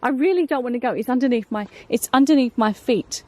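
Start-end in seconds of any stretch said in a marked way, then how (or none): none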